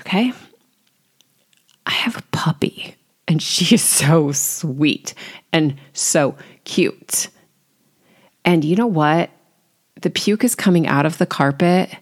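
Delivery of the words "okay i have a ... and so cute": whispered and slow, soothing herself; calming self-talk. Retold or imitated. Mom calming herself over the carpet, slow whisper. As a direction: whisper it slowly to calm yourself down. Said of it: "I had to pause and take a breath".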